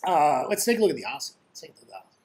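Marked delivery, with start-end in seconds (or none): 1.5-2.2 whispered. Pretend. Trader whispering an aside to himself while distracted. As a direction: whisper it, far softer, no voice.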